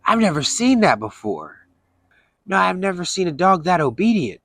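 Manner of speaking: a hint of a wide-eyed kid marvelling; amazed teen boy. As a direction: neighbor kid amazed at the obedient dog, lightly voiced. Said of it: "and he says".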